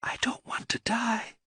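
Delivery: barely audible terrified whisper. A narrator's reading. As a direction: breathe it out, almost no voice. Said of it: "in a voice almost too low to hear, she whispered".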